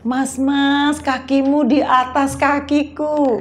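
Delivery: slow, gentle Javanese politeness; drawn-out Javanese lilt; Javanese manner. Retold or imitated. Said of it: "Orang Jawa kalau kakinya keinjek ngomongnya gimana".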